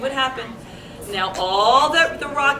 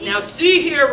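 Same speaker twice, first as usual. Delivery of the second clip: mild roar; 3 semitones higher, 6 dB louder than usual. A narrator's reading. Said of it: "roared Papa Bear".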